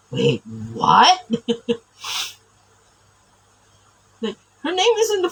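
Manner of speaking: surprised double take. Retold or imitated. Sudden surprise at seeing her name.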